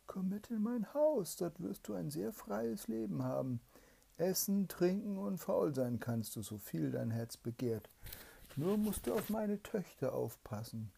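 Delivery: high, loud coaxing spirit voice; tempting spirit. Acted. Evil spirit tempts the soldier to his palace, high coaxing voice. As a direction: lure him in high and sweet like a demon.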